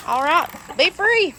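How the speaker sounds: excited release. Real, not acted. Releasing chickens from pen.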